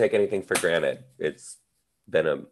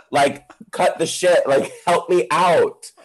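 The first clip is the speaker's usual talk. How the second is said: exasperated; 10 semitones higher, 5 dB louder than usual. Retold or imitated. Retold frustration with teacher.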